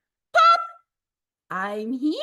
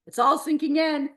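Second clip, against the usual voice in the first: plain reading voice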